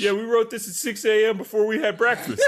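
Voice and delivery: high voice breaking into laughter; silly high writer, half laughing; put-on writer voice. Writers confessing to an early start, high put-on voice. Acted writers answering the director.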